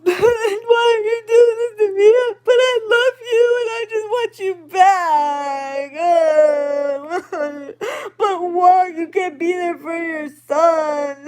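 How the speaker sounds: high, crying, pleading mock sob; imitating a sobbing plea. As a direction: act out her tearful begging, voice breaking. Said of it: "like, crying, like, not even just, like, a tearing up cry".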